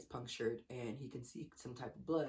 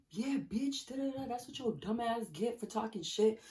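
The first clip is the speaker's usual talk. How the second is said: higher, louder retold tirade; yelling at the guy she just punched, raised and heated; going off, yelling; 7 semitones higher, 4 dB louder than usual. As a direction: tear into him, voice up and loud. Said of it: "I was just going off".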